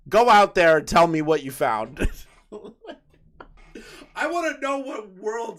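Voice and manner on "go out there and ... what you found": loud acted command; raised, playful ordering voice; mock send-off. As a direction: send them off like an explorer. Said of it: "push him into the outside world and go".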